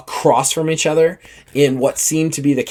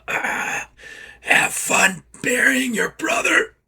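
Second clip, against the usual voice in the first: whispered taunt through gritted teeth; dying whisper; 10 semitones higher, 4 dB quieter, slower than usual. Acted. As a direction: breathe it out like a dying man's last sneer. Dying enemy's last taunt.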